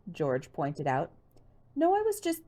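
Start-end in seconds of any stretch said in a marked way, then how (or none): 1.8-2.4 flustered. Acted. Flustered Nancy.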